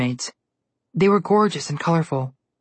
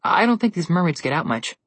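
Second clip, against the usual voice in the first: usual speaking voice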